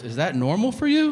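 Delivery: high, rising disbelief; incredulous wife. Retold or imitated. Wife questions husband's bathroom habits, incredulous. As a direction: imitate a wife who can't believe it.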